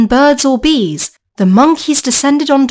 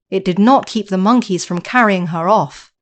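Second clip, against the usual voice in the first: plain reading voice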